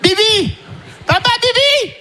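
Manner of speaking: very high shrill repeated "Bibi!"; toddler yelling. Retold or imitated. Father imitates his son demanding the bottle at night, very high. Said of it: "il veut son biberon".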